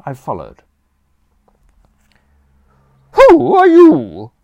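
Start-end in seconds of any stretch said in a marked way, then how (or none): 3.1-4.3 high, loud, slow imperious aunt; Aunt Agatha demanding. A narrator's reading. Aunt confronting a stranger in the hall, loud and demanding. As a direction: bark it like a formidable aunt. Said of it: "Aunt Agatha demanded".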